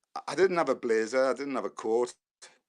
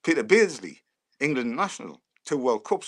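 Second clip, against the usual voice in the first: ordinary talk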